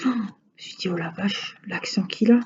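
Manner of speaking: ordinary talk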